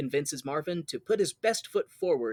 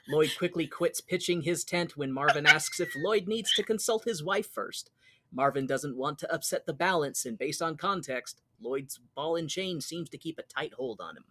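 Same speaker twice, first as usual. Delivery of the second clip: usual speaking voice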